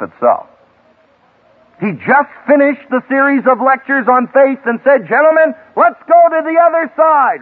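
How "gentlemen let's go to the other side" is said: high, loud call to set out; rally the men to cross the lake; commanding call. Retold or imitated. Jesus calling disciples to cross.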